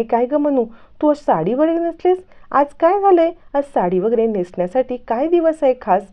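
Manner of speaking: a light playful voice for Bhumi, surprised at the sari; cheerful young woman, curious. A narrator's reading.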